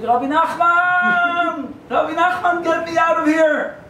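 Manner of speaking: high, slow, drawn-out wail for help; comic scream. Retold or imitated. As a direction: scream up to the rabbi from hell. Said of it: "and he starts screaming".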